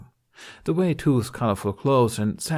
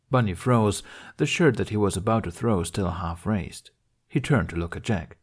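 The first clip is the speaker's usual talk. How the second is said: plain reading voice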